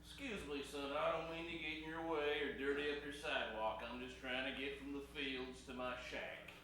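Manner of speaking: low, humble voice; old field hand. Acted. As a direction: shuffle in as a mud-caked old man.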